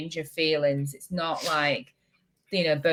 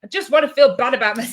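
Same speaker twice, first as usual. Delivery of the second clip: laughing; 5 semitones higher, 6 dB louder, faster than usual. Real, not acted. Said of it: "in this minute".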